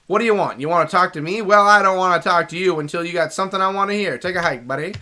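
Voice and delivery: brusque cartoon Canadian character; South Park Canadian. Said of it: "made him sound South Park Canadian".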